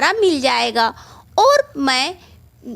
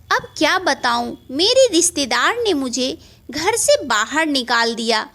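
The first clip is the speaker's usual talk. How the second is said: plain reading voice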